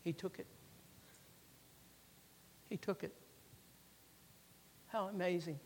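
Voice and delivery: very soft, with long pauses; quiet wonder, barely pressed out; hushed awe. Real, not acted. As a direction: let it drop to a reverent hush. Hushed awe at the retold moment.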